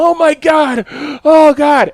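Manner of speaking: high, loud gasping exclamation; overwhelmed outcry. Retold or imitated. Patient as the swab is pulled out, overwhelmed cry. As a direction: cry out as it is yanked free. Retold relief after swab yanked out.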